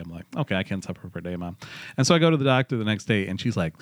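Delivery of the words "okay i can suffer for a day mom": resigned. Retold or imitated. Resigned reply to mom.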